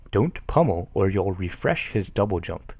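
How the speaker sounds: usual speaking voice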